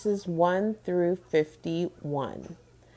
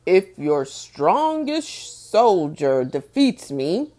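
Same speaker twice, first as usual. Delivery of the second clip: a hint of a booming giant, louder; 4 semitones lower, 6 dB louder, slower than usual. Giant Goliath challenging King Saul's army, lightly booming. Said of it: "he boomed".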